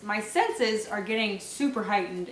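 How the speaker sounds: ordinary talk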